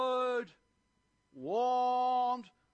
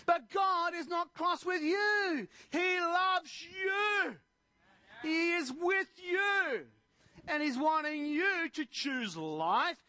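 preacher moved near tears; fervent preaching; 7 semitones higher, slower than usual. Acted. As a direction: plead with them, almost crying.

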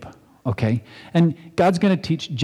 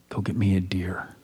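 put-on breathy whisper of an old blind father; whispered request; 6 semitones lower, 7 dB quieter than usual. Isaac whispering to Esau to fetch a deer. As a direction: whisper it like a frail old man asking a favor.

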